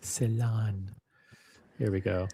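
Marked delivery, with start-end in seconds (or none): 0.0-0.9 Celan softly as "sih-LAHN"; practised pronunciation. Shown as a demonstration. Host echoing his friend, Celan said softly as "sih-LAHN". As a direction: try out Celan quietly, stressed at the end.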